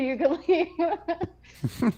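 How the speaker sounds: slow, high, laughing dismissal; dismissive officer. Retold or imitated. Officer dismissing recruit, slow and amused. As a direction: drag out the dismissal. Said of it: "He's like".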